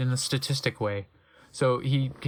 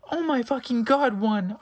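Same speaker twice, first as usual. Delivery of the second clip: mock sad shout; 8 semitones higher than usual. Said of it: "I shooted sadly".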